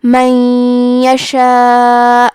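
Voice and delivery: من یشاء with the ن merged into ی, nasal, and a long madd; idgham with ghunna. Shown as a demonstration. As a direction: glide من into یشاء with a nasal hum. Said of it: "حکم کو غنہ اور تشدید کے ساتھ ادا کریں گے".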